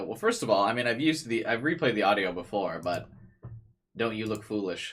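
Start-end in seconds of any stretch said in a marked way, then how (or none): none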